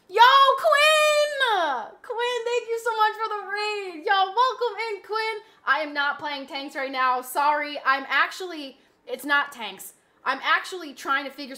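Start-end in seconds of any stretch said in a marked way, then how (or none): 0.1-5.4 high, loud hype greeting; high hyped welcome; excited greeting shout. Real, not acted. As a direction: hype up the raider as they arrive. Greets a raider loudly.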